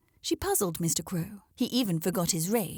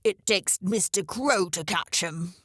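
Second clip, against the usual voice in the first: croaked. A narrator's reading. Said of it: "he croaked".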